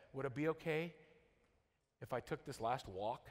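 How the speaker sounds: low, soft, tentative question; retold child's request, quietly and gravely; hushed plea. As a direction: let it sink away with a long pause. Boy's plea before leg amputation.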